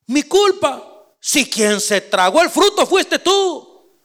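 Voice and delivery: mock-outraged husband throwing it back; indignant Adam. Adam throwing the blame back at Eve, outraged and high.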